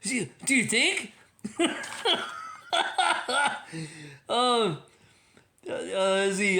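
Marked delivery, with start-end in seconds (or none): 1.4-4.2 a long, high laugh. Real, not acted. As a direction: keep laughing.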